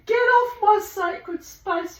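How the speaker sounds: high, slow, loud order; drawn-out pretend scolding; mock anger. Shooing the cat from her garden, mock-angry command. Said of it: "he knows I'm not really mad at her".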